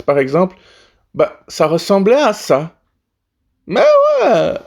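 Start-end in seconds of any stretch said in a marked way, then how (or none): 3.7-4.5 rock star shout. Acted. Shouted rocker exclamation.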